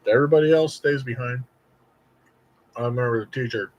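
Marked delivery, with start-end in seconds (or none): 0.0-1.4 high, eager voice hyping him on; mock cheering kid. Classmates egging him on, a high mock cheer. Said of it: "But still".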